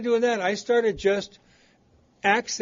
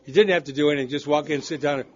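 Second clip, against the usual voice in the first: normal talking voice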